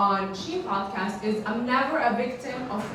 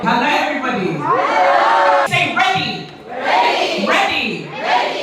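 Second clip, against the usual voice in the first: crowd call; 3 semitones higher, 6 dB louder than usual. Real, not acted. Host warming up crowd.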